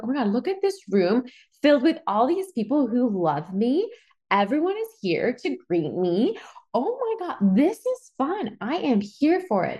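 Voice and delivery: high-pitched, giddy excitement; excited. Retold or imitated. Bride voicing her excited companion going down the aisle, excited. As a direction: gush with delight, pitch climbing high. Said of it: "she was so excited".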